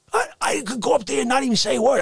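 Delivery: ordinary delivery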